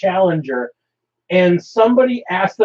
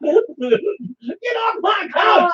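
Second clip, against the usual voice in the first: high, slow shouted [name] line; [name] impression; 9 semitones higher, much slower than usual. Joking about Saturday live streams with a slow, shouted [name] impression. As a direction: drag it out and yell it like [name]. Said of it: "I'm more like [name]".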